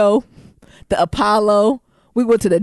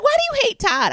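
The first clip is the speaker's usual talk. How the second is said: pitched way up, imitating the roommate; squeaky innocent question in her voice; a sheltered roommate's puzzled question; high mock roommate voice. Roommate voiced in retold story.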